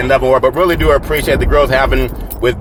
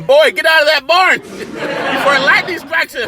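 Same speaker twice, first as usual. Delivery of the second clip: much higher mock scolding of a boy, laughing through it; great-grandfather's warning; 11 semitones higher than usual. Host imitates his great-grandfather shooing him from the barn, laughing mock scold. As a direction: imitate an old patriarch shooing a kid. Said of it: "Also well known for saying".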